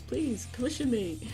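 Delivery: ordinary delivery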